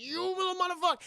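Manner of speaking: high, drawn-up indignant curse; mock seething anger. Burglars to the taunting kid, mock anger. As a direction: act out being riled by a taunt. Acting burglars' angry reply.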